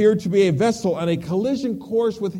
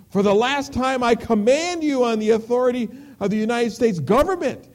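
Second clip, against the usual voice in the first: angered captain, loud and raised; growled angry order; 4 semitones higher, 4 dB louder than usual. Acted. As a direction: lay down the law over the radio. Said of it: "the captain growled back over the radio".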